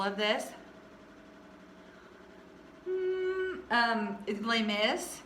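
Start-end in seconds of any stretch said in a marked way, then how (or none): none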